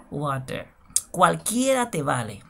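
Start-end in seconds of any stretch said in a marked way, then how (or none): 0.1-0.7 "water" as "wa-ter", open a, falling away; variant pronunciation. Shown as a demonstration. Teacher closing the list of ways, "water" as "wa-ter". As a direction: say "water" with clear t and final r. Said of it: "se pueden decir de diferentes maneras".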